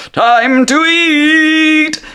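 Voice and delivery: high, loud, very slow drawn-out genie call. Acted. GM as Genie tossing the food, high slow call. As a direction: stretch it like a gleeful cartoon chef.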